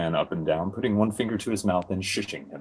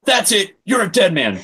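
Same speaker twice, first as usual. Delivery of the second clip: angry threat; 11 semitones higher, 7 dB louder than usual. Acted. Jay threatens Schnary.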